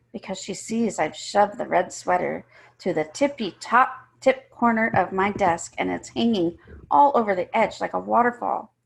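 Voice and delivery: plain reading voice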